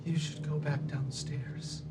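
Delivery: soft, slow character voice; hushed warning. Acted. Mortified companion warns the maimed man, hushed and slow. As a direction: urge them back quietly and slowly.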